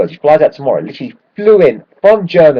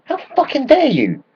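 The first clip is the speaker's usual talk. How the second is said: indignant outrage; 14 semitones higher, 3 dB quieter than usual. Retold or imitated. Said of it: "He's saying".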